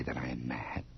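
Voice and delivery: hushed, unvoiced whisper. Narrator whispering to the listener about his madness. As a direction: breathe it out like a secret.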